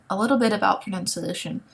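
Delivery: ordinary talk, nothing marked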